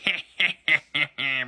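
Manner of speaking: imitated villain cackle; Green Goblin. Villain laughing over his praying victim, mock cackle. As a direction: cackle in mock evil glee. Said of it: "and be like".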